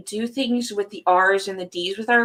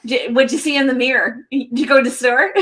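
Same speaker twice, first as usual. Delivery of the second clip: put-on a light Michigan accent, words run together, rising louder at the end; 3 semitones higher, 6 dB louder, faster than usual. Imitating Michigan speech to a friend, in a light Michigan accent. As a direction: lightly mimic the Michigan accent, condensing each question. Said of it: "the dialect of Michigan".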